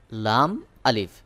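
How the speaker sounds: লাম, আলিফ said slowly as two distinct letters with a clear break between them; slow, deliberate letter-by-letter demo; slow and separated. Shown as a demonstration. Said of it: "অথচ এটা কিন্তু দুইটি হরফ".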